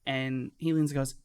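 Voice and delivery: usual speaking voice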